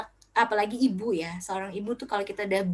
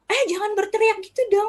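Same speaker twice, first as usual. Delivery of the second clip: high, louder mock telling-off; scolding parent; 12 semitones higher, 5 dB louder than usual. Parent reacting to a shouting child, mock scolding. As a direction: imitate an exasperated parent's protest.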